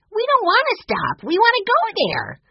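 put-on very high, loud little boy protest; upset child's cry. A narrator's reading. Benny protesting the cancelled visit, crying out. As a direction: cry it out, pleading and indignant. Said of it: "Benny cried".

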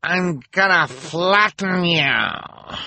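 high, slow, menacing villain voice; villainous threat. Acted. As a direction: stretch it out like a gloating villainess. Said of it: "Esme called".

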